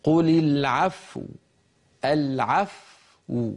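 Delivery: العفو closing with silent fa then rounded lips; a correct pause on العفو; correct stop. Shown as a demonstration. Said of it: "انظروا".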